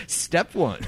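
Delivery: said through a laugh. Real, not acted. Co-host cracking up at a joke about her music, laughing. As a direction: laugh your way through the words.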